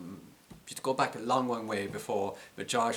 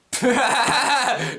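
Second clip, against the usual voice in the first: loud, high laugh burst; burst of laughter; 12 dB louder than usual. Real, not acted. Laughing at own point about accents, loud high laugh. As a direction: break into a big high laugh.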